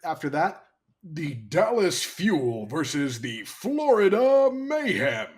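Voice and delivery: slow booming matchup call; fighting game announcer. Acted. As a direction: hype it like a fight card. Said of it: "I'm not gonna keep doing it".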